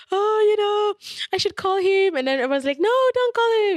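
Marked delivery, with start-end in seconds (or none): 0.1-2.1 high, whiny mock tipsy clubgoer; wailing about calling the ex, hammed-up and high. Drunk emotional clubgoer quoted. 2.8-3.8 high, loud mock protest; mock chorus protest. Friends stopping her, quoted.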